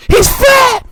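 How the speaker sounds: sudden high, loud yell; shouted punchline. Acted. Explaining why Tennessee might not like Joe, shouted. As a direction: blurt it out at full shout.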